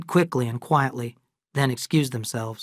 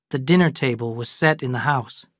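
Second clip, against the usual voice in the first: plain reading voice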